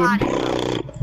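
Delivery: body bump, deep and low. Acted. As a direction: drop way low. Chant ends in low voice.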